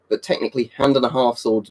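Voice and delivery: ordinary talk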